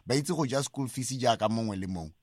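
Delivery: ordinary talk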